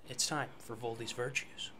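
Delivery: hushed, eerie host announcing; soft, spooky lead-in to the segment; horror host. Acted. Intro to the Temple of Wisdom segment.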